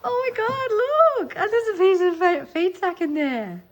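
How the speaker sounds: high, loud and gushing with delight; thrilled. Real, not acted. Finds feed sack in the book.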